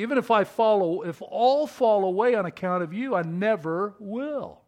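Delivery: high, loud, emphatic declaration; bold vow. Acted. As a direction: swear loyalty firmly, slowing on the last words. Peter's bold vow, acted.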